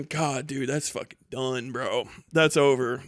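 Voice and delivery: normal talking voice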